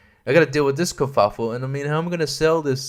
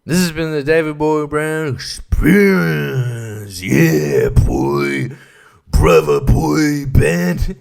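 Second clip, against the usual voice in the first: 1 mock announcer sign-off; 5 dB louder, much slower than usual. Signing off vlog in announcer voice.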